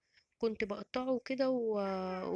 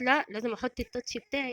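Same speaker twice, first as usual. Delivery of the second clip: ordinary delivery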